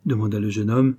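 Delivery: plain reading voice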